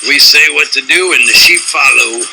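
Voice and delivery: mocking the government bossing the sheep. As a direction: sneer it as the arrogant government.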